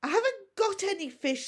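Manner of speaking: a hint of a dragon tongue; Hiccup in Dragonese. A narrator's reading. Hiccup answering the dragon in Dragonese, lightly voiced. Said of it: "said Hiccup in Dragonese".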